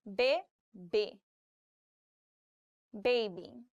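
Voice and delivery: ordinary talk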